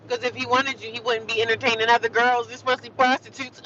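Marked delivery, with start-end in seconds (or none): none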